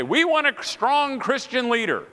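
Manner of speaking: imitated audience member. Woman answering the speaker's question, mock earnest. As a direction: chant it like an eager pupil's answer. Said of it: "somebody raises their hand and say".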